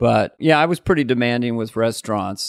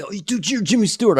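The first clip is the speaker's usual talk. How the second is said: higher-pitched imitation of backstage chatter; lightly voiced impressed onlookers; backstage admirers; 7 semitones higher than usual. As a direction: imitate someone gushing backstage. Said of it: "Everyone backstage".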